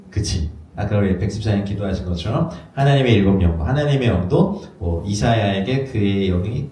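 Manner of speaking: normal talking voice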